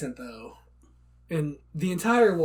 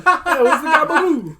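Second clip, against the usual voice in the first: loud, high laughing mock Jersey accent; 11 semitones higher, 12 dB louder than usual. Podcast friend joins the joke, loud mock Jersey accent. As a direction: belt it out like a Sopranos goon.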